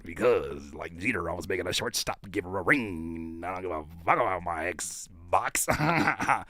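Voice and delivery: very low, soft player impression; mock smooth talker. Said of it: "Why is he talking like that?".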